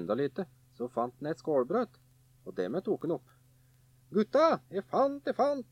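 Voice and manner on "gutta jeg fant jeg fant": high, slow gleeful calling to his brothers; excited call. A narrator's reading. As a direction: draw out the find proudly. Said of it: "sa han".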